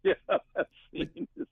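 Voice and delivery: high-pitched, laughing too much to finish; real laughter swallowing the words. Real, not acted. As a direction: crack up mid-sentence. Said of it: "I'm sorry I'm laughing so much".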